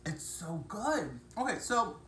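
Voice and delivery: talking through a mouthful; mouth full. Real, not acted. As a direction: say it while chewing. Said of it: "I'm trying to talk without my mouth full".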